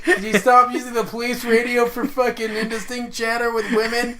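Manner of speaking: high, loud imitation breaking into laughter; laughing mock complaint. Co-host mimicking policeman's radio complaint, laughing mock yell. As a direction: quote the irate cop through laughter.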